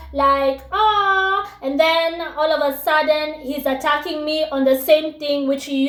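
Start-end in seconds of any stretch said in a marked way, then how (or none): 0.7-1.5 high, drawn-out "aww" sound; cooing at cuteness. Acted. Guy at first finds her teeth cute, mock cooing. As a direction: imitate a smitten guy cooing. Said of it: "he told me how cute they were".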